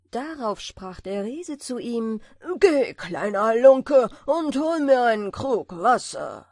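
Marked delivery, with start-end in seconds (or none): 2.6-6.5 loud, bossy giant command; cartoon brute. A narrator's reading. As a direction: bark it down like a brute. Giant orders the tailor. (polternder Riese)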